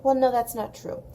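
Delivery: ordinary talk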